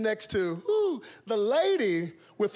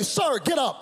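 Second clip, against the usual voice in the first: stewardess waking him; 6 semitones higher, 3 dB louder than usual. Retold or imitated. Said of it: "wake me up and say".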